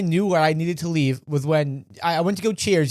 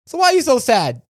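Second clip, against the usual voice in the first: higher, louder imitation of a prying woman; retold nosy stranger; 7 semitones higher, 4 dB louder than usual. Woman at the bar asking his friend why he's sad, retold nosy stranger. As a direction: put on her pointed, concerned question.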